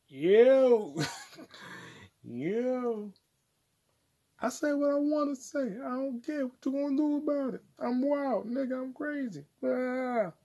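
high wild voice. Acted.

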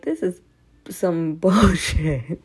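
slow laughing "bullshit"; laughing exasperation. Retold or imitated. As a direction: drag it out through a laugh. Quoting her own frustration.

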